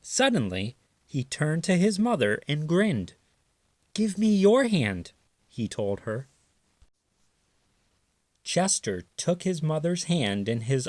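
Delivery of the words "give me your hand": high, loud and excited; a kid with a happy plan; eager little raccoon. A narrator's reading. Chester grinning at his mother, high and excited.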